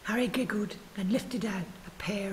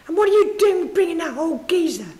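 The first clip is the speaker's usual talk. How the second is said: angry shout; 8 semitones higher, 11 dB louder than usual. Acted. Tough man shouts at Julian.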